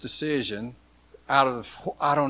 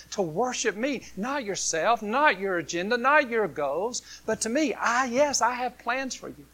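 higher, earnest pleading voice of Jesus; Jesus speaking; 7 semitones higher, 4 dB louder than usual. Acted. Jesus tells the disciples they missed the point, pleading. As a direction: let Jesus correct them, gently insistent. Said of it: "he's going".

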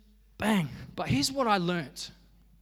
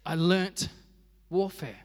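ordinary talk, nothing marked

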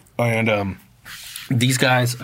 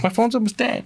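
imitating the girlfriend, much higher; girlfriend's voice; 12 semitones higher than usual. Girlfriend answers him before a possible fight, imitated high voice. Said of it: "And she's like".

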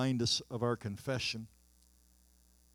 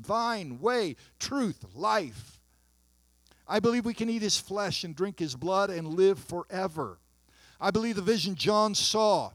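louder and higher, slow swelling proclamation; preaching at full force; 4 semitones higher, 7 dB louder than usual. Acted. Pastor proclaiming restoration to the congregation, full force. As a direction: proclaim each phrase like a climax.

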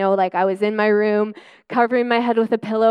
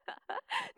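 high rueful little laugh; short laugh; 15 dB quieter than usual. Real, not acted. Admitting her doubt about breakthrough, rueful laugh.

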